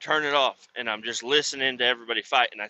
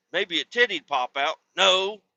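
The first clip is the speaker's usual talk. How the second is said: ordinary talk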